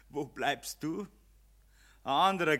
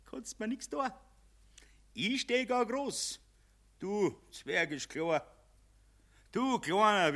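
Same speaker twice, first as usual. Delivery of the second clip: high mocking taunt; 8 semitones higher than usual. Mammon mocks Jedermann.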